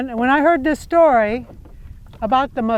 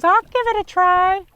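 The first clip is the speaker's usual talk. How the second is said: higher, small muskrat voice; a tiny animal eagerly volunteering; little muskrat voice; 7 semitones higher than usual. Acted. Muskrat offering to dive for the earth, small higher voice. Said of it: "The muskrat goes".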